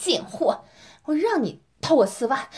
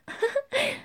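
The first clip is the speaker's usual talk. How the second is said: mocking laugh; 12 semitones higher, 6 dB quieter than usual. Teasing partner in dominant roleplay.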